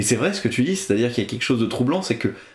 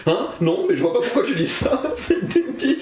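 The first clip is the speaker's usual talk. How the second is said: laughing; 10 semitones higher than usual. Retold or imitated. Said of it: "Et il fait".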